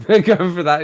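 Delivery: normal talking voice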